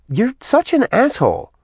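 high-pitched, exasperated woman's voice; acted high-voiced indignation; exasperated scolding. Woman scolds him in a quarrel.

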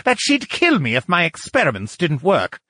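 high, indignant retort; snapped. A narrator's reading. Lucian defending his place with the Duchess, snapping. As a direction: bristle at the very suggestion. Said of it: "Lucian snapped".

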